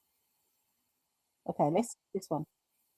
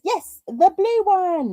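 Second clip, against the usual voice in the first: drawn-out excited read; 8 semitones higher, 6 dB louder, slower than usual. Retold or imitated. Reading a comment with emphasis.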